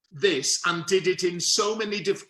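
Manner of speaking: usual speaking voice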